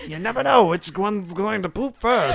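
loud high laughing yell; laughing shout. Real, not acted.

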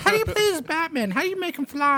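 high, whiny clueless-gamer voice; mock a confused kid with a squeaky put-on voice; console kid. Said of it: "Those dirty console kids".

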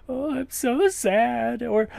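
high, slow, hammed-up fake sadness; mock sad. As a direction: whine it out in mock misery, slowly. Mocking dramatic queens at reunion.

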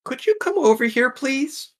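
very high, halting creature voice; hesitant little creature. Acted. Sewer creature hesitantly calls Root over, very high voice. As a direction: squeak it out haltingly, then call out.

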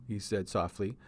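plain reading voice

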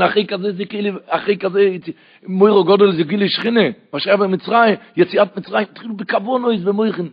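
loud, high-pitched impassioned sermon; preaching at full force. Acted. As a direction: drive the verses home at the top of your voice. (דרשה נלהבת בקול רם)

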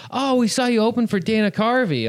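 high, gushing imitation; excited fans. Two people recognizing the comic working the door, excited. Acting out second pair of fans.

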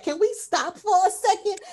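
high-pitched imitation of someone panicking; mock freaking out. Host voices the freaked-out mother at the seance, mock panic. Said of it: "Toni Collette is freaking out".

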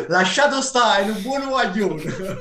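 high, loud shouted call in Neapolitan dialect. Retold or imitated. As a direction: yell it down from a balcony in Neapolitan dialect. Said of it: "strillò".

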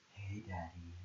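low, soft seductive voice; sexy voice. Pretend. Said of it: "what's your best sexy voice?".

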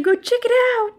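very high mock jeer; taunting villain. Villain husband gloats about the broken snowmobile, mock taunting. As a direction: crow it in a high put-on voice. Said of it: "then he's, like, laughing".

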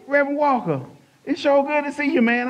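high, loud friendly greeting; lightly voiced hearty stranger; a hint of a cheerful stranger greeting him in a store; stranger's greeting. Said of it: "That's how he was talking".

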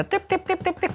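high quick tip-tip-tip of mincing steps; high tiptoe patter. Retold or imitated. Imitating his own tiny shuffling steps in high patter. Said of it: "ножками ото як".

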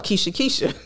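ordinary delivery

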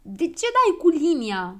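high, loud, slow protest; indignant shouting. A narrator's reading. Girl struck with a ruler, shouting in protest. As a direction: cry out at whoever hit you. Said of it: "începu ea să strige".

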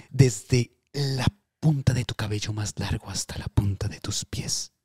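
airy whisper, no voice. Pretend. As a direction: breathe the words out softly. Whispered part of dream message.

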